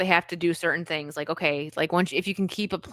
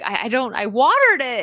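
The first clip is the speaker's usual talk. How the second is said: stammered, mock-upset protest rising louder and higher; 5 semitones higher, 5 dB louder than usual. Retelling a man with a dead plant, mock upset. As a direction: play it lightly upset and defensive. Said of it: "And he's, like, all upset".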